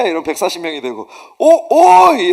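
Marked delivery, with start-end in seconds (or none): 1.4-2.3 high, loud mock holler; calling out. Seminarian summoning another whole class, loud holler. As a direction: bellow to summon the crowd.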